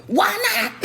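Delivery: high, loud mock outburst; indignant high-pitched protest; defiant challenge. Retells own defiant outburst to officer.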